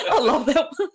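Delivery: real laughter through the words; delighted giggle at the punchline; laughing. Real, not acted. Laughing at her own story.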